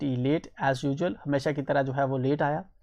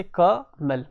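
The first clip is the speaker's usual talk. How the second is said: "कमल" in two beats, क and मल; split into syllables; 2 semitones higher, much slower than usual. Shown as a demonstration. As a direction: separate क from मल in "कमल". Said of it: "दो आवाज़ें निकल रही हैं".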